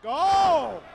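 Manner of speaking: sudden loud gasp of excitement; excited shout. Real, not acted. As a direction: yell it as the move lands. Big move in the match.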